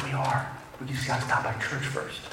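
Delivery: imitated hushed reply. Boyfriend answers her behind his parents, imitated hushed reply. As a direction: answer her under your breath. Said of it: "she whispers to, to me".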